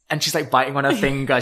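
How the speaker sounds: ordinary talk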